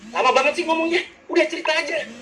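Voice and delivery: high-pitched mock impatience; orang B tak sabaran. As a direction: cut in like an exasperated, impatient listener. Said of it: "curhat ke orang B gini". (menyela tak sabar dengan nada tinggi)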